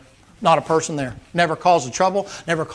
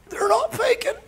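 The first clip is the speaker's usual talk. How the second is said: high, breathless, soft admission; still winded; 5 dB quieter than usual. Retold or imitated. Beaten heckler admitting the fight was real, breathless. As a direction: squeeze it out with no air left. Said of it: "when he caught his breath".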